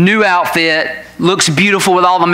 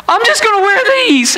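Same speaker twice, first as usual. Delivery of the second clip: put-on much higher, stubborn tearful teen voice; whiny teen. Daughter refusing to change her pants, high whine. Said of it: "And she says".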